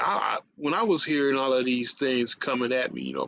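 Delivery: ordinary talk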